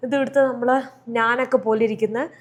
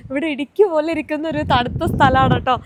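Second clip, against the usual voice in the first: ordinary delivery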